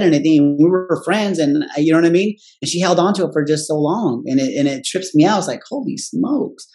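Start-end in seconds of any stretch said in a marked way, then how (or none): 5.7-6.7 stunned disbelief. Retold or imitated. Stunned realisation of old grudge.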